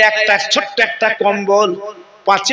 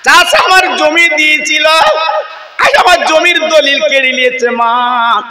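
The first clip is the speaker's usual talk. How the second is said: high loud sermon wail; raised, loud lamenting cry; anguished wail; 6 semitones higher, 3 dB louder than usual. Acted. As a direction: pour out the grief to his mother. Son lamenting to mother.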